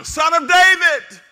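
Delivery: very high, loud and slow cry. Acted. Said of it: "he only shouted louder".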